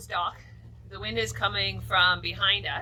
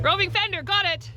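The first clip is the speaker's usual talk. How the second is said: raised, high call back; 9 semitones higher, 5 dB louder than usual. Real, not acted. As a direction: sing out that you heard the order. Calls back acknowledging husband's order.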